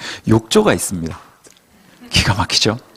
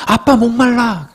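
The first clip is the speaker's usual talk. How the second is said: put-on whiny child; 4 semitones higher, slower than usual. Son Yechan voiced in story.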